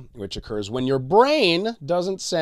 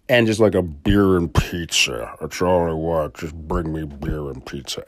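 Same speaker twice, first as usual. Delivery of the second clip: lower, sluggish "just bring me beer and pizza" voice, a light put-on; lazy winter craving; 7 semitones lower, 3 dB louder than usual. Craving beer and pizza all winter, lightly voiced sluggish self. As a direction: slump into a lazy, greedy drawl.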